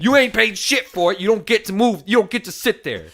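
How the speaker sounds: high, loud hammed-up rant; mock angry rant. Ranting at a driver in her parking spot, hammed-up anger. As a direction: tell off the squatter, hammed up.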